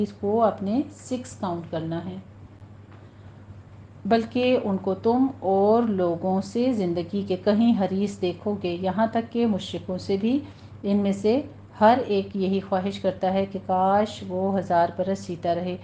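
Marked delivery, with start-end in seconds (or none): none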